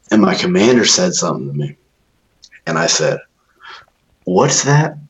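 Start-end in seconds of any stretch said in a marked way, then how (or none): 4.2-5.0 higher-pitched innocent question; breezy retold reply to a superior; retold casual query. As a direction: toss it out lightly, pitched up. Recruit's casual reply to commander.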